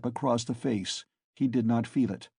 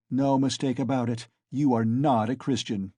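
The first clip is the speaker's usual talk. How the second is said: usual speaking voice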